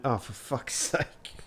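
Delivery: ordinary delivery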